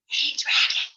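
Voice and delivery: unvoiced whisper "Hey, dragon". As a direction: whisper into his ear. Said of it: "She whispered in his ear".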